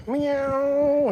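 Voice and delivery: high mock meow; meowing at cats. Calling to the cats with a high meow. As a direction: do a squeaky cat meow.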